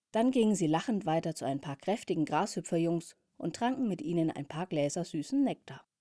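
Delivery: ordinary delivery, nothing marked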